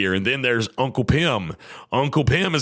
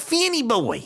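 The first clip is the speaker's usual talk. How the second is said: put-on much higher, sing-song "Finny boy"; doting uncle; 12 semitones higher than usual. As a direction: coo it fondly at a toddler. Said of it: "So I say to him, like".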